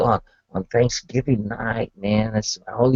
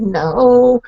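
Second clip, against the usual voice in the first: insistent denial; 9 semitones higher, 4 dB louder than usual. Retold or imitated. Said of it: "I said".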